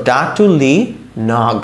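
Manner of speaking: ডাটুলি, a pause, then নগ on its own; broken-up demo. Shown as a demonstration. Teacher swapping P for G, broken-up demo. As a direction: split off the final NOG. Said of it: "এরকম হয়ে যেত".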